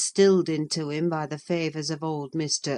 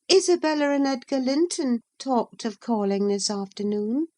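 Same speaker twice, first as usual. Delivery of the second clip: put-on high girl's voice, casually breaking news; Catherine's voice; 8 semitones higher than usual. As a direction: drop the news lightly, pitched high. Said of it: "she said at the conclusion".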